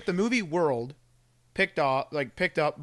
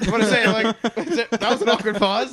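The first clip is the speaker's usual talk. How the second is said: laughing; 7 semitones higher, 8 dB louder than usual. Real, not acted. Laughing at awkward pause.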